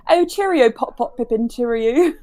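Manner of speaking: mock English toff chatter; exaggerated British. Parody of talking to parents on the phone, hammed-up British. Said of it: "I'm always like".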